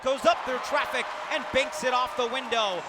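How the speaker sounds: punchy, forceful play-by-play; excited basket call. Real, not acted. Announcer calls Marshall scoring through traffic, excited.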